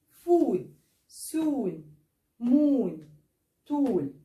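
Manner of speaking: each word said with a long oo vowel. Shown as a demonstration. Teacher modelling long o words for students. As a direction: draw out the vowel in every word. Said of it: "اللونج او".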